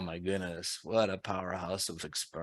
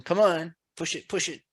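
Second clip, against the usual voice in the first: hyped up; 11 semitones higher, 8 dB louder than usual. Real, not acted. Host cheers on poll responses.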